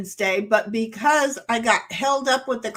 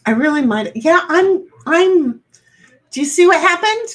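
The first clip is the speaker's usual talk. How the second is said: flustered; 6 semitones higher, 8 dB louder than usual. Real, not acted. Flustered realization, rising loud.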